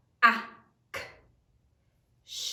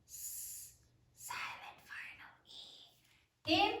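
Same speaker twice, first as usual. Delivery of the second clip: soft unvoiced whisper; 7 semitones higher, 11 dB quieter, much slower than usual. Shown as a demonstration. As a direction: breathe the words out quietly. Whispering letters while spelling.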